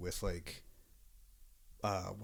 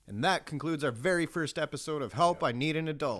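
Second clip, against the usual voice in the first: higher, projected announcer delivery; podcast sign-off; 6 semitones higher, 3 dB louder than usual. Real, not acted. Host closing the very first episode, announcer sign-off. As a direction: wrap up the show like a host.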